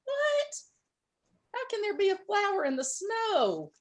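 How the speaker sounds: high, startled disbelief at a flower; astonished squeal. A narrator's reading. Groundhog finding a flower in snow, amazed. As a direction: gasp it out in wonder.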